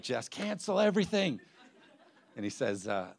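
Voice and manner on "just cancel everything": mock exclaim. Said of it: "He's like".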